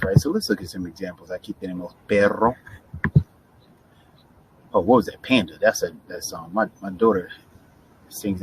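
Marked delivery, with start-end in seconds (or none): 2.1-2.6 PERRO with the RR trilled; rolled double R. Shown as a demonstration. Teacher giving an example, PERRO with a trilled RR. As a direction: roll the RR in PERRO. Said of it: "situation there with the double R's".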